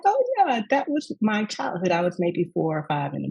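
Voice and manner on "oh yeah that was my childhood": sudden high-pitched delight at remembering; light up as the memory hits; delighted realization. Retold or imitated. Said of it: "I was like".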